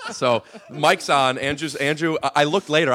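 normal talking voice

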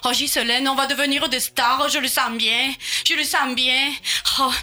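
she's sure they'll be stars; excited dreaming cousin. Acted. Provincial cousin gushing to Ghislaine, excited.

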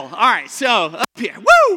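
loud call to the room; hype shout. Real, not acted. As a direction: fire the crowd up, Woo high and loud. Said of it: "hyping up the crowd".

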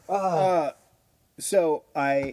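normal talking voice

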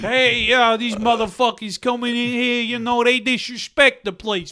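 Italian-American movie tough guy. Acted. Comic mimicking Spike Lee actors in jungle restaurant, riled Italian-American rant. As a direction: complain like a Brooklyn pizzeria hothead.

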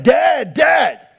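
put-on high loud call of a child bursting in; child calling loudly. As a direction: call out like a kid bursting into the office. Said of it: "she's like".

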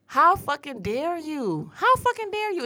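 high-pitched, indignant outrage; outraged, pitch climbing sharply; very upset. Real, not acted. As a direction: throw it out in angry disbelief. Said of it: "She's very upset".

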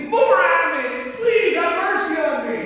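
loud, high cry for mercy; desperate beggar's full-voiced plea; pleading shout. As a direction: shout past the crowd, begging.

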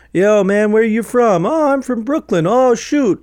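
two New Yorkers sizing each other up; Northeast city guys. Retold or imitated. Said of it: "if you know anybody from the Northeast".

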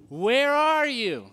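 high, slow, drawn-out call of God. Acted. God seeking his hidden creation, calling out. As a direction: call out gently like God searching. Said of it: "Listen to this".

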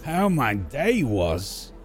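slow, puzzled stranger voice; drawn-out disbelieving NPC; incredulous stranger. Acted.

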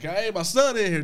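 mild imitation of a mother yelling; retold yell. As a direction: call out as if to attackers, briefly imitated. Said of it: "she was yelling back".

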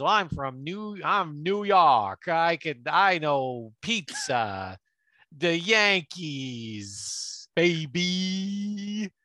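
slow, hammed-up New York accent. PI boasting to the Canadian bartender in a New York accent. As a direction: drawl it out like a swaggering New Yorker.

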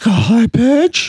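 high squeaky greeting; Jack impression. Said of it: "I got Jack".

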